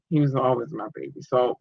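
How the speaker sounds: normal talking voice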